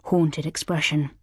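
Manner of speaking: plain reading voice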